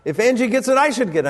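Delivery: put-on high whiny little girl; child's protest. Daughter argues with father, high whiny child voice. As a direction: insist like a stubborn child. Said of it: "and she said".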